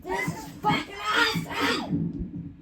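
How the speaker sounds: excited shout through a squeaky pitch shifter. Shown as a demonstration. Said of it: "Let's go even higher".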